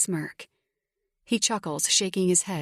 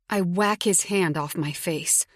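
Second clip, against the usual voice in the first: usual speaking voice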